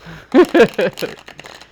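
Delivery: bright burst of laughter; loud laugh. Real, not acted. Laughs at her own joke.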